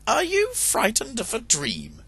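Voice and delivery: high hen voice, teasing her husband; a hen mocking his fear; high chiding hen; scolding hen. Bertelotte speaks to husband.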